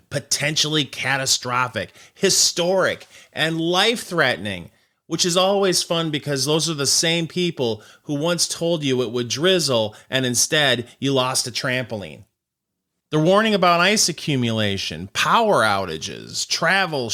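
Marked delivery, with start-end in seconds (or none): none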